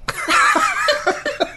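breathy high laugh. Real, not acted. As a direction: cackle at own attempt. Laughs at own accent.

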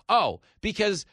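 high, loud, slowed-down hammering of each phrase; worked-up, emphatic rant; exasperated shouting. Real, not acted. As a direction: drive the point home at full voice. Shouts the obvious answer at them.

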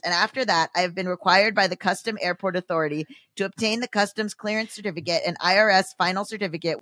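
fast monotone reading; rushed email read. Acted. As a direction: rattle through the long scam email. Rapid reading of long email.

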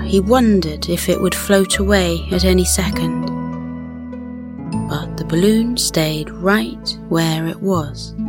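ordinary delivery, nothing marked

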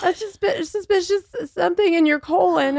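young doctor nervously delivering bad news; nervous resident. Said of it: "and she's like".

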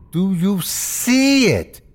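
slow, high, strained insistence; insistent. Acted. Injured Terry demanding of narrator, insistent. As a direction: stretch the question out, pitch climbing high.

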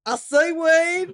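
slow, drawn-out Forrest Gump impression. Cohost answers a cohost's joke in a slow Forrest Gump voice. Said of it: "our Forrest Gump impressions".

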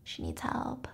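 conspiratorial whisper. Retold or imitated. Relatives plotting to commit her, whispered. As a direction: whisper the plot quietly. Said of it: "family members or friends who are like".

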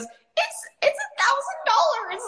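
tiny, very high squeaky mock-child voice; voice going up. Uncomfortable seller naming the price on a discovery call, squeaky high. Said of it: "your voice goes".

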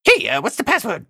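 squeaky little doorman demanding the password. Acted. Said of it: "a little voice says".

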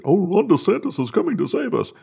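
high, mocking hopeful voice; mocking naive Republican. Host mocks Republicans dreaming of a DeSantis rescue, high mocking voice. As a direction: lilt it up like a starry-eyed believer. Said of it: "this fantasy that".

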